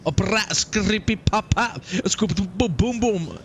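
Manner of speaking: trilled brrrah and popping nonsense syllables; mock rapper ad-libs. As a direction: spit goofy rap noises.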